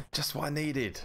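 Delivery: high, mock-delighted sarcasm; sarcastic. Shown as a demonstration. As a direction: show off your sarcastic voice. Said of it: "That was my sarcastic voice".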